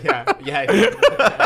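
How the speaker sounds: high laughing yeahs. Real, not acted. Telling the taxi story to mates, laughing through the words.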